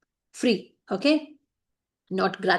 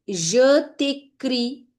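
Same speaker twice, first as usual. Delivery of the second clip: slow dictation; 3 semitones higher, 3 dB louder, much slower than usual. Shown as a demonstration. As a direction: spell out je t'écris slowly. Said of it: "dictating slowly".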